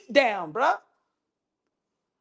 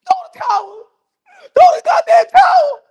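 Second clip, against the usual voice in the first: high, very loud frantic yell; mock yell; 11 dB louder, faster than usual. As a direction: yell at the trainer to end it. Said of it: "Bernie the boxer telling Bo Mac".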